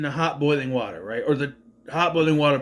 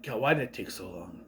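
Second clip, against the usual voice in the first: weary complaint; 7 semitones lower, 9 dB quieter than usual. Retold or imitated. Muttered complaint at slow kill.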